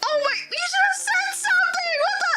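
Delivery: high, squeaky scolding; hammed-up frantic reproach; mock exasperation. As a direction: scold in exaggerated disbelief. Retold exasperated reply.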